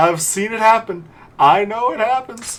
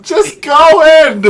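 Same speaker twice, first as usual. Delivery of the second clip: loud, raised-pitch roar at the ball; exasperated shout; 6 semitones higher, 12 dB louder than usual. Real, not acted. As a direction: shout it in sheer frustration. Frustrated at missed putt.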